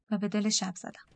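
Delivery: usual speaking voice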